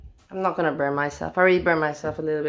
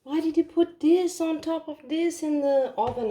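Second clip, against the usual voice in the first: higher, mock-irritated quoting of him; retelling the husband's annoyed question, pitched high; 9 semitones higher than usual. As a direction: imitate his fussy complaint. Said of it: "He was annoyed".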